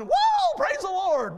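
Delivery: high, loud whoop falling into praise; exuberant praise. Acted. Preacher acts out someone running around church, exuberant praise. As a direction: imitate a shouting, running worshipper.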